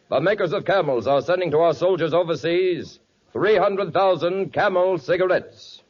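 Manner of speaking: ordinary talk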